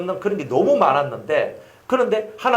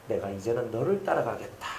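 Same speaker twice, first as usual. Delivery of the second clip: softer, lower weak voice; frail father's vow; 5 semitones lower, 8 dB quieter than usual. Retold or imitated. Dying father telling his son he will follow him, softly. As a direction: say it faintly like an old sick man. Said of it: "아버지가 그때 돼가지고 나중에".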